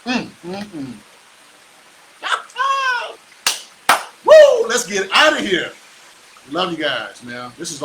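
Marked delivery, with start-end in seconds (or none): 2.2-3.1 high laugh. Real, not acted. Cackles at his own hype. 4.2-5.7 excited shout. Real, not acted. Host signing off his live stream, whooping and shouting. Hyped sign-off cheer to viewers.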